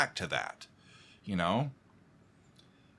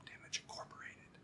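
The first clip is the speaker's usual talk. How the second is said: whisper; 22 dB quieter than usual. Shown as a demonstration. Fan showing the listener the song's whispered line, whisper. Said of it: "and then the whisper".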